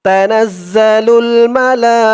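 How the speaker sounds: slow melodic tilawat chant; Quran recitation. Shown as a demonstration. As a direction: draw out each word in recitation tune. Recites the phrase slowly. (ধীর সুরেলা কুরআন তিলাওয়াত)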